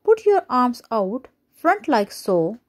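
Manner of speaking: ordinary delivery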